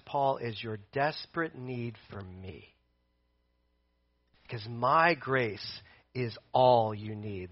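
low, measured reassurance, softening; God's gentle counsel. Acted. Preacher as God comforting Paul about the thorn, low and gentle. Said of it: "God says".

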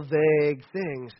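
usual speaking voice

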